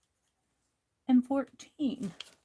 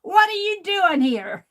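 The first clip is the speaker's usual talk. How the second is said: fast, loud, high demand from a rude boss; mock-hostile boss, loud and quick; boss's gruff challenge; 7 semitones higher, 7 dB louder than usual. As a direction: bark it at the unwelcome visitor. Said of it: "That's how he said it in my dream".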